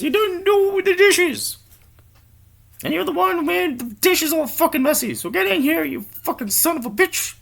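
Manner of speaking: very high, loud nagging screech; nagging wife yell. Acted. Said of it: "his wife says".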